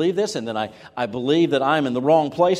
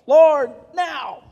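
much higher, loud urgent cry; panic prayer; 13 semitones higher, 10 dB louder than usual. Retold or imitated. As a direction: demand help right now. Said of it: "God answers panic prayers".

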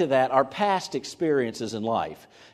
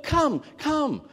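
gentle beckoning; 13 semitones higher than usual. Retold or imitated. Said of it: "he had a smile on his face".

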